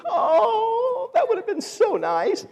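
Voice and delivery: very high, put-on whimper; hammed-up high-pitched longing; imitated wistful wail. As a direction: mimic a jilted girlfriend gushing tearfully. Imitates jilted attendant's wistful outburst.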